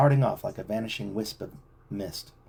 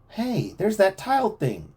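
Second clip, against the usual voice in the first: slow, higher excited cry; exclaiming; 10 semitones higher, slower than usual. A narrator's reading. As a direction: point it out with amazement. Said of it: "Chet exclaimed".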